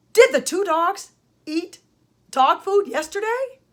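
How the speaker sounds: high questioning lilt ending upward on "yesterday"; question intonation. Shown as a demonstration. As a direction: ask it as a question, "yesterday" going up at the end. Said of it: "My voice went up at the end".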